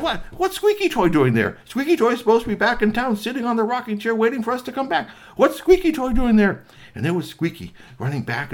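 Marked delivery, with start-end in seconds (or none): 0.0-6.6 high, loud disbelief; astonished outburst. Acted. Curtis finding Squeaky Toy on the glacier, astonished. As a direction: exclaim it indignantly, pitched well up.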